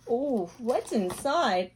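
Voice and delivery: usual speaking voice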